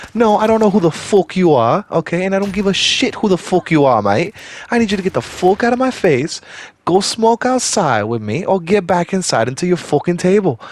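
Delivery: mimicked British accent. Guard answering [name] back, mimicked angry British accent. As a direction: give it back in a loud mock British accent. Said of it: "in the same accent he gave me".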